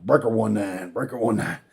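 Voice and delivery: low, quiet radio chatter; radio call. Retold or imitated. Calling on CB radio.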